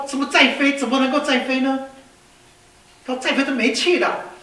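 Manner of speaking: comic outrage at the order to climb; alarmed protest. Acted.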